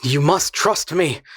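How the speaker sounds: slow, earnest plea. A narrator's reading. The man coaxing the armed girl, slow and earnest. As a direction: coax her to trust you.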